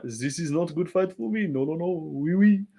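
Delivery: generic put-on French accent. Shown as a demonstration. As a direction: demonstrate a cartoonish French voice. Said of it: "I'm just doing, like, a fucking stereotypical".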